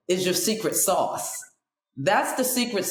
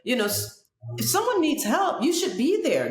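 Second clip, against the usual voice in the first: pitched up, fast lecturing; high preachy quote; 7 semitones higher than usual. Retold or imitated. Lecturing friend on the subway steps, high and fast. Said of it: "I said".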